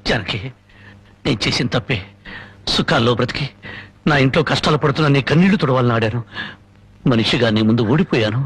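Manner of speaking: low, heavy voice admitting defeat; ashamed confession. Acted. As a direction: confess it low, as a beaten man. Husband confesses his failure. (సిగ్గుతో తక్కువ స్వరంలో ఒప్పుకోలు)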